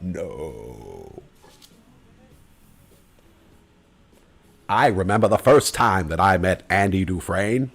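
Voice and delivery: low, measured narrator impression; Morgan Freeman. Actor reminiscing about a first meeting, low impression. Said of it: "That's my Morgan Freeman impression".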